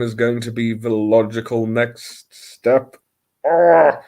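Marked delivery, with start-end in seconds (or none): 3.5-4.0 high, loud "ah" of dread; hammed-up wail of dismay; mock anguished cry. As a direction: cry out as if overwhelmed. Dismay at joining Instagram.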